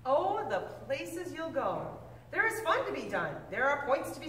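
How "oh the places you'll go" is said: plain reading voice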